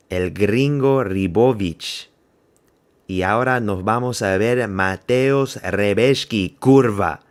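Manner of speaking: rolling, drawn-out Spanish announcer flourish; put-on Spanish accent. Fan announcing El Gringo and Mateusz Rebecki, hammed-up Spanish accent. Mock Spanish call of the fighters.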